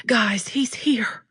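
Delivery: urgent, slow, breathless whisper; loud whisper. Acted. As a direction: warn the room in a loud whisper. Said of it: "I loud whispered to the room".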